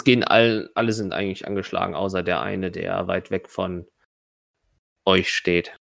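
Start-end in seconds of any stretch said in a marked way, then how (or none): none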